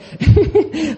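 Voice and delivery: high, loud scornful laugh; God's mocking laugh. God laughs at the nations, a loud high mocking laugh. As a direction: laugh down at the rebelling peoples. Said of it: "y él se ríe".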